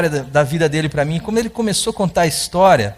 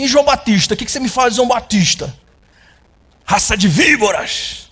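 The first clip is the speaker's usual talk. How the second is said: challenging quote; 4 semitones higher than usual. Retold or imitated. The man challenges the pastor.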